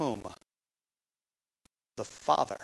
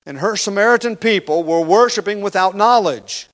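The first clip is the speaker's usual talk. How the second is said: emphatic preaching; 4 semitones higher, 7 dB louder than usual. Acted. Preacher driving home accusation.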